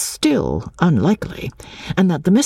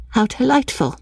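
listless, understated "delightful"; dull, unenthusiastic reply; 10 semitones higher than usual. A narrator's reading. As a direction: say it politely with little interest. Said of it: "in a dull voice".

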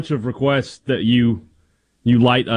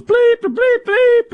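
high-pitched imitated bleeps; mock censor bleep; 4 dB louder, much slower than usual. Imitates censor bleeps over swearing.

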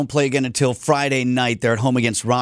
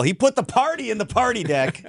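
mock home run call; 10 semitones higher than usual. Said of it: "The judges also would have accepted for that call".

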